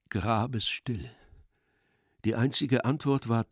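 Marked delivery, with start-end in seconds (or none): none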